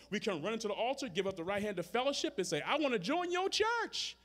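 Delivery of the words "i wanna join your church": eager new member. Retold or imitated. Mock eager new member at altar.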